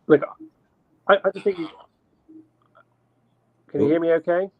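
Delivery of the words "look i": ordinary delivery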